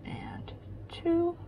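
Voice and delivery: whispered count; whispering. Real, not acted. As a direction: breathe the count out softly. Counting pins under her breath.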